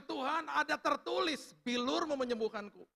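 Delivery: high, slow, ringing cry; retold shouted declaration. Sick man shouting scripture as the heat rises, declaring. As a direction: draw out each phrase as a shouted proclamation. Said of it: "Saya teriak".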